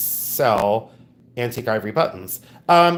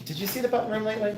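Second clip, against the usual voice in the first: higher, fast teasing singsong; 9 semitones higher, 7 dB quieter, much faster than usual. Real, not acted. Teasing her husband about the button room, quick and high. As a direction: needle him playfully, rushing it.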